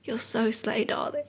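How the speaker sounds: soft, put-on British murmur; quiet British accent, fading into a whisper. Imagined admirer whispering in her ear, soft British accent. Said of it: "doesn't have to be in a British accent".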